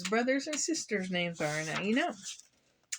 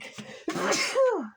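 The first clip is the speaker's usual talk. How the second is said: sudden high cough; real cough; 11 semitones higher than usual. Real, not acted. Reader reading to kids, coughing mid-story. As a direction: cough from the dust. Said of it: "Excuse me".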